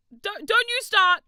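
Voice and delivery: high, loud mock warning; warning chat. Real, not acted. Streamer loudly warning chat not to start. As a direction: wag a finger at them with your voice.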